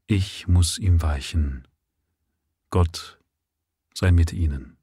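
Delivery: slow, gentle reassurance; comforting. A narrator's reading. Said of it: "sprach er tröstend".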